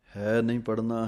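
usual speaking voice

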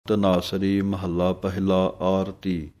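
ordinary talk, nothing marked

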